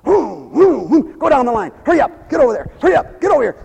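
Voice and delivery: high, urgent shooing; bossy mock volunteer; hurried volunteer. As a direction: hustle people down the line. Volunteer rushing the bread line.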